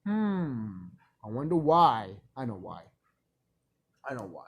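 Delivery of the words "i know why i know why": hushed, lower, in on the secret; knowing quiet aside. Acted. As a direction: drop much softer and lower, as if sharing a secret. Knowing aside after rhetorical question.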